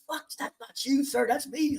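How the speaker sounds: high-pitched joking protest; imitating a protest to the lieutenant; mock high protest. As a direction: squeak out the objection. Teller quoting protest to officer.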